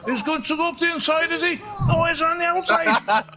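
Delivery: high, excited Welsh commentary; Welsh accent. Pretend. Commentator following Phil's overtake, excited Welsh accent. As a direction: call the overtake in lilting Welsh. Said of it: "this is an accent I've been doing".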